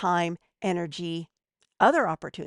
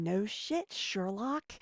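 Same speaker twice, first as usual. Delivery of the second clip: sarcastic, muttered much softer under the breath; snarky aside; 9 dB quieter, much slower than usual. Retold or imitated. A snarky thought about a pushy SEO seller, muttered. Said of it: "There's a snark".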